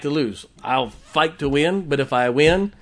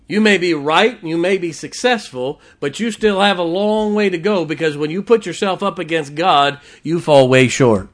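preaching at full force; 6 dB louder than usual. Acted.

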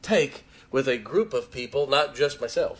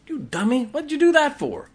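higher, scolding mock rebuke; 12 semitones higher than usual. Preacher mocking a harsh husband's rebuke. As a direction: scold her like a fool, half in fun. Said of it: "You think I'm gonna say that to her?".

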